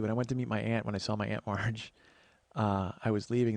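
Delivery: usual speaking voice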